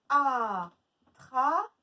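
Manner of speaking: ATTRAPER as long loud a, long tra, short per; stretched A syllables. Shown as a demonstration. Teacher recapping where A is heard, stretched syllables. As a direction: hold both a's of Attraper. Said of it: "on l'entend".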